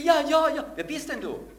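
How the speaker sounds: high, loud, impatient panic; frantic shout. Acted. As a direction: yell it out while hanging on. Man on cliff yelling.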